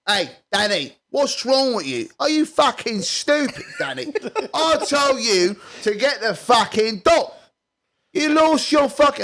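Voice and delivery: yelling at Danny in a hammed-up rage; angry rant at Danny. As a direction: scold Danny like a furious boss.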